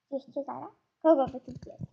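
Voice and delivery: ordinary talk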